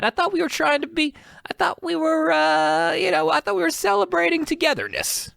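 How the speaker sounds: very high-pitched, hammed-up protest; mock hurt plea. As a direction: plead with her in a squeaky high voice. Mock hurt plea to the absent girlfriend.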